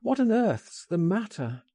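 high, urgent question; concerned cry. A narrator's reading. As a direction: exclaim it in alarm. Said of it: "I cried".

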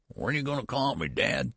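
a high, quick quaver; quavering old son. Acted. Son asking his father while leaving the nursery, high and quavering. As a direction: let the old voice wobble up high. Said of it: "he quavered".

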